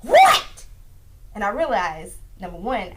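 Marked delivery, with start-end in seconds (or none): 0.0-0.4 very loud, high-pitched retold shout. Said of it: "I sound like [name]".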